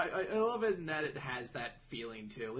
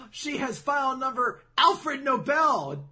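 high, loud, slow mock alarm; alarmed revelation; 9 semitones higher, 8 dB louder, slower than usual. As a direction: land the name like a shocking reveal. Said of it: "he's like".

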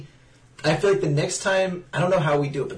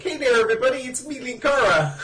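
high, hammed-up Canadian accent; mock Canadian accent, pitched up; 9 semitones higher, 3 dB louder than usual. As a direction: greet the audience like a Canadian host. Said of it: "a Canadian accent".

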